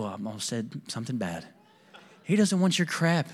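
normal talking voice

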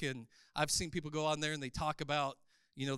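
ordinary talk

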